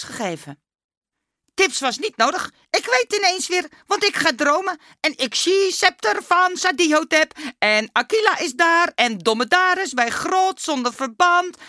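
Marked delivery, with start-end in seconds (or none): 1.5-11.6 put-on high, loud, breathless-to-tell mummy boy; excited Dummy. A narrator's reading. Dummy eagerly telling the teacher his dream, high excited voice. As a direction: blurt it out high in broken Dutch.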